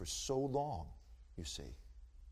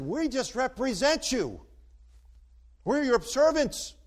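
loud, slower, higher declaration; emphatic quote; 12 semitones higher, 10 dB louder, slower than usual. Retold or imitated. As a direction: hammer the chairman's challenge home. Quoting chairman, emphatic.

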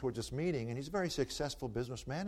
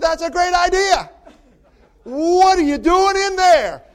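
businessman's astonished cry, much higher, much louder and slower; amazed shout; 22 dB louder, slower than usual. Acted. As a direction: blurt it out in total amazement. Businessman's amazed reply in joke.

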